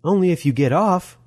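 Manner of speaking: high, teasing woman's voice; playful high-voiced jab; grinning Molly.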